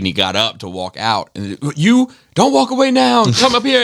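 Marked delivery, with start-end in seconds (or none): 1.7-3.8 high-pitched, calling someone back; mock preacher hollering at a deserter; imitated altar call, pitched way up; insistent preacher.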